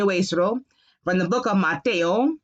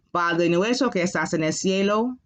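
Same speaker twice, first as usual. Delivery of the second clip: fast, run-together reading of the line; quicker reading; faster than usual. Shown as a demonstration. Teacher reading the prayer to the class, quick. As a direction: rattle it off at speed. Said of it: "I'll go a little slower".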